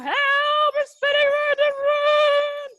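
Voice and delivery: high, loud cries for help; spinning in office chair. Pretend. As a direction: yell as if spinning out of control. Pretend boat spinning round.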